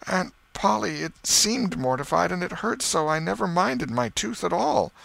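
high, plaintive excuse; pleading, high-pitched self-defence; sheepish boy. Acted. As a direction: explain himself in a small boy's whine. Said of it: "felt a little foolish".

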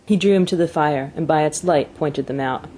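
usual speaking voice

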